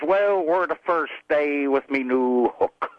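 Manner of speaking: put-on slow, drawn-out pirate accent. Pirate admitting his mishap, slow pirate accent. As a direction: stretch the punch line in pirate accent. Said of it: "slid back and forth into that pirate accent".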